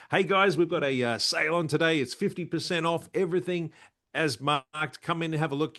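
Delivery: high, bright shopfront sales patter; spruiker's pitch. Shown as a demonstration. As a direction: call shoppers in like a market spruiker. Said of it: "give us a little bit of a sample".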